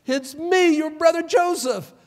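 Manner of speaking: high, loud cry of joy; joyful reveal. Acted. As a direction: burst out with the news. Joseph reveals himself again.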